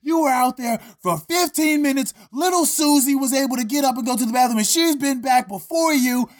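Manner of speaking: high, far-off furious teacher yell; teacher going off. Retold or imitated. Teacher yelling at Earl for a long bathroom break, high and furious. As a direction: scream at the kid like a furious teacher.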